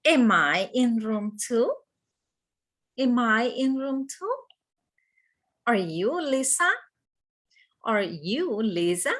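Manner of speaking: yes-no questions rising at the end on "two" and "Lisa"; question intonation. Shown as a demonstration. Teacher modelling questions for students, voice rising on "two" and "Lisa". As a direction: lift the pitch on the final "two" and "Lisa". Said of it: "Pay attention to the intonation".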